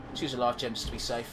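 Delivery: ordinary delivery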